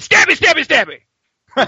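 high, loud sing-song; mock gleeful killer. Rick stabbing Shane, high and loud sing-song mockery. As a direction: chirp it like a giddy little stabber.

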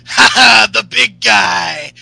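loud, high, hammed-up meathead with forced laughs; Ryback. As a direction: shout it like a cocky wrestler, stretching the catchphrase. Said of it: "Ryback's like".

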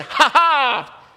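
loud, high "gotcha" ha-ha; triumphant mock laugh. Atheist neighbor jumping from behind a bush, loud gloating laugh. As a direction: burst out crowing, loud and high. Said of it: "jumped from behind a bush and said".

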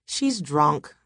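plain reading voice

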